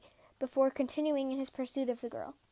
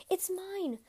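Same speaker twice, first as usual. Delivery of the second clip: put-on higher, plaintive boy's protest; crying protest; 6 semitones higher than usual. A narrator's reading. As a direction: lightly voice a boy crying out in protest. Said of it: "The shepherd boy cried and said".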